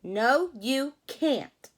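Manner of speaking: loud, harsh monkey no; mean refusal. Acted. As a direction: shut him out bluntly. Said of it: "said the rabbits".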